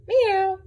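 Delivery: playful cat meow. Acted. Cat meows in story.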